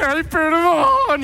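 high, slow, sheepish apology; drawn-out timid high-pitched apology; sheepish son. Acted. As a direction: back away squeaking an embarrassed sorry. Prodigal flees in embarrassment.

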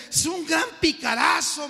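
high, sneering put-down; scornful street youth. Retold or imitated. Street youth badmouthing the preacher, high and scornful. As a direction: spit out the insult with relish.

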